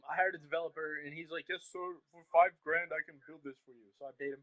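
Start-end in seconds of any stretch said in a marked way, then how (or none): 1.5-3.9 put-on Indian accent. Seller quoting his developer's offer, in a put-on Indian accent. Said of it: "that was an Indian voice".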